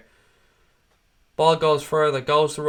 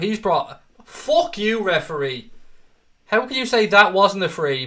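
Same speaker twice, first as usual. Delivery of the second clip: higher, sharp angry yell at the ref; angry outburst; 6 semitones higher, 5 dB louder than usual. Real, not acted. Fan yelling at the referee over a foul, angry outburst. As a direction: lash out at the referee.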